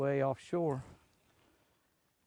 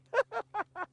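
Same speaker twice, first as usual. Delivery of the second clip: laugh. Real, not acted. Amused at his own remark.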